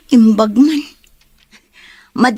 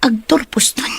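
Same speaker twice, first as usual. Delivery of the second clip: a private whisper to herself; hushed inner monologue; whispered thought; 3 dB quieter than usual. Acted. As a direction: breathe it out unheard, as inner thought. Inner thought, unvoiced line.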